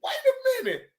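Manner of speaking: high scared voice. Retold or imitated. Answering Just Jay's panel invite, high nervous voice. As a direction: go high and timid. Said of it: "I say".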